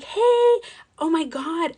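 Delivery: high, loud effusive greeting; gushing. Retold or imitated. Imitating friendly support agent greeting a customer, gushing. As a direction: gush like the nicest helper ever. Voicing the very nice support girl.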